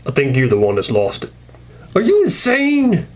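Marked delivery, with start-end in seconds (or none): 1.9-3.0 much higher, slow indignant cry; high, drawn-out disbelief; outraged squeak. Acted. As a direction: sound appalled at Morgan. Rick asks Morgan, outraged.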